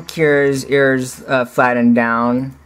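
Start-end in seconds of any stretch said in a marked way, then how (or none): none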